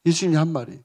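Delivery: normal talking voice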